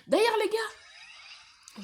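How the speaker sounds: high, slow, loud drawn-out call; excited call. Real, not acted. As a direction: call out to the viewers, stretched high.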